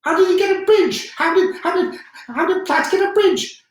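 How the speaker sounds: high, loud furious yelling; furious shout. Acted. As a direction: bark it out in a rage, stumbling over the words. Furious commander repeats demand.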